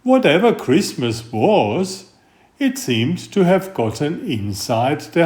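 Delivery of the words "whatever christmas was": ordinary delivery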